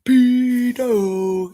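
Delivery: loud high jeering yell; shouted insult. Retold or imitated. Prisoners jeering at the nonces, loud high shout. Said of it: "Everyone's shouting".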